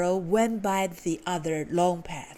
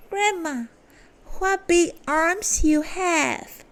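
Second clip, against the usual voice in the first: put-on girl exclaiming; 8 semitones higher than usual. A narrator's reading. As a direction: marvel at it, pitched up. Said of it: "she cried".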